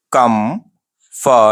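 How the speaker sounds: "कंफर्टेबल" broken slowly into KUM - FAR - TABLE; three separate slow words, full "table"; wrong pronunciation. Shown as a demonstration. Teacher imitates students, "कंफर्टेबल" broken into slow pieces. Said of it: "ये wrong pronunciation है".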